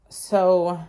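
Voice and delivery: normal talking voice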